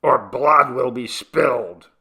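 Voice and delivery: loud, menacing warning; bellowing. A narrator's reading. Chabo behind a beech threatening attackers, loud bellow. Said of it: "bellowed Chabo".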